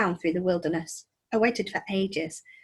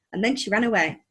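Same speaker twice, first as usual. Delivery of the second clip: usual speaking voice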